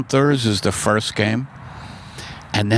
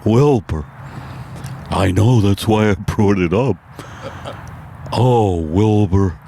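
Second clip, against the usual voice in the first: a dazed horse struggling to breathe; Mister Ed on acid; 3 semitones lower than usual. Acted. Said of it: "my impersonation of Mister Ed".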